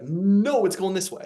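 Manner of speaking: loud, high-pitched mock command; emphatic overruling. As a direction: cut in as the universe overruling someone's plans. Universe overruling his life plans.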